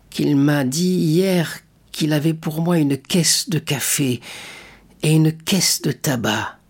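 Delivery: a lightly voiced old father, slow and hushed; slow, hopeful old man's murmur. A narrator's reading. Grieving old father recalls a promised gift, slow murmur. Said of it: "murmura le pauvre père".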